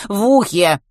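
loud, high exclamation; emphatic correction. A narrator's reading. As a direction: correct it loudly and insistently. Boy corrects himself loudly.

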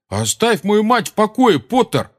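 high-pitched indignant outburst; angry demand. A narrator's reading. Seamus telling Potter to leave his mother alone, angry.

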